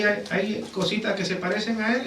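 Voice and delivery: normal talking voice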